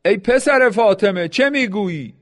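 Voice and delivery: a narrator's light shout across the field. A narrator's reading. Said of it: "شمر فریاد زد". (فریادی ملایم و بلند)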